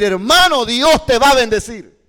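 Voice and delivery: loud, high proclamation; emphatic preaching. Shown as a demonstration. Teacher shows students how to proclaim a blessing, loud and emphatic. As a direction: thunder it out from the pulpit. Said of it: "¿Sí ve? Estoy diciendo lo mismo".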